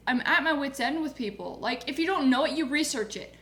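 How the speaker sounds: ordinary talk, nothing marked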